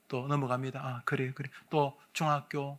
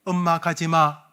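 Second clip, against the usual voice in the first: slow, loud call to mother; first group shout, louder and slow; 10 dB louder, slower than usual. Shown as a demonstration. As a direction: shout it slowly. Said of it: "저랑 같이 외칠까요".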